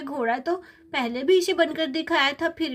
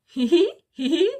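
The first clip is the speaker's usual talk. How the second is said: giggling; 3 dB louder than usual. Acted. Child laughs.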